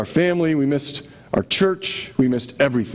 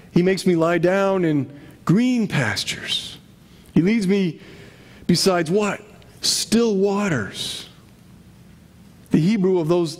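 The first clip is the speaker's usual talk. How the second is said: scripture recitation. Acted. Reciting Psalm 23.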